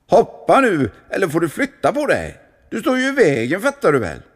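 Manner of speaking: high, loud impatient yell; impatient shout. Acted. Someone on the diving tower yelling at the monk to move. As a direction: shove him out of the way with the voice.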